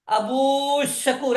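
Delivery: "ابو شکور" with the "و" held very long; drawn-out long vowel. Shown as a demonstration. Teacher testing whether the waw is lengthened, "ابو شکور" with drawn-out "و". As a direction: stretch the final vowel of "ابو" slowly before "شکور". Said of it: "آپ واو مدہ پڑھتے ہیں".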